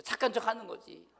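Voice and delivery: ordinary talk, nothing marked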